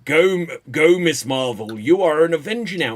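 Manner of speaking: low, heroic proclamation; Thor. Retold or imitated. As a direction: proclaim it like a deep-voiced hero. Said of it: "just so that Thor can say".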